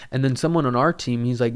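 usual speaking voice

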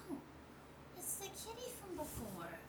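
a tiny distant voice remarking; small voice. Acted. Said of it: "hear a smaller voice".

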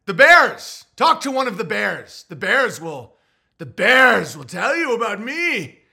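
high, loud, slow lordly boast; mock grand proclamation. To the clerk asking for references, a loud lordly proclamation. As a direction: proclaim the Bears like a herald. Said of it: "Who's your references".

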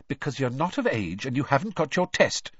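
sharp, impatient reply; snapping. A narrator's reading. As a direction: snap it back at him. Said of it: "snapped Missus Weasley".

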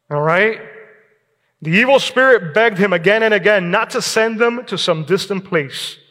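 ordinary delivery

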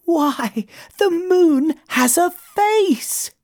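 high, drawn-out wonder; slow, astonished high moth voice; amazed little moth. A narrator's reading. Moth amazed at moon's reflection.

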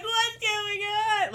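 high-pitched wailed question; mock bawling. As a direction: imitate herself bawling in confusion. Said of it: "just fucking bawling in my living room".

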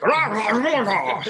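thick Scottish growl like Groundskeeper Willie; broad Scottish accent. Retold or imitated. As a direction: bark nonsense syllables in a heavy Scottish burr. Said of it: "the janitor from The Simpsons is like".

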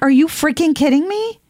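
high, incredulous scolding; exasperated outburst. Retold or imitated. Speaker scolding schools over cat kids, high exasperated disbelief. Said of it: "I'm like".

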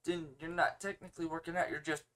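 ordinary talk

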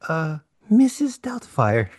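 slow high Mrs Doubtfire with a hint of Spanish accent. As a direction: drawl the name in a light Spanish accent. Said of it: "You sound like Spanish right there".

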